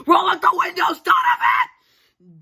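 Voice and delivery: shrieking yell at Donovan; frantic yell. Acted. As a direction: scream it, high and loud. Yelling at Donovan in car.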